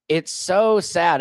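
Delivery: ordinary delivery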